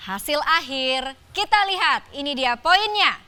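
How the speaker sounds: slow, drawn-out score reveal; booming prize announcer. Acted. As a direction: build suspense toward the winner.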